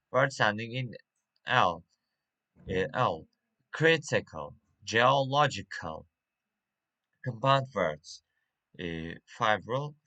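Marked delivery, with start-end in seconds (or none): 3.7-6.1 "critical" and "geological" stressed third syllable from the end; "critical, geological" with weak final two syllables; antepenultimate stress. Shown as a demonstration. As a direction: hit CRIT in "critical", LOG in "geological". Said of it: "stress, uh, on antepenultimate".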